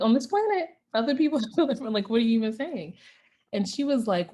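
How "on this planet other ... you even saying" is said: higher and fast, utterly baffled; incredulous echo. Retold or imitated. Little girl echoing mom in disbelief, higher and fast. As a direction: throw her words back at her in disbelief. Child repeats mom's words incredulously.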